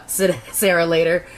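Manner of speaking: usual speaking voice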